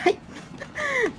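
high squeaky burst of laughter; nervous high laugh; squealing laugh. Real, not acted. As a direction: let out a shrill panicked giggle. Laughing while fleeing.

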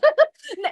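high real laugh; sudden real laugh; burst of laughter. Real, not acted. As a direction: laugh out at the joke. Laughing at own joke.

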